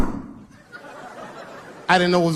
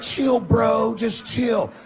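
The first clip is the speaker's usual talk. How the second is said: calm him down, voice up and jittery; nervous frat bro; 6 semitones higher, much slower than usual. Retold or imitated. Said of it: "they were like".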